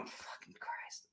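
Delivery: slow, hushed whisper under the breath; whispered self-reproach. Retold or imitated. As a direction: whisper it in frustration at yourself. Imitating actress's exasperated aside.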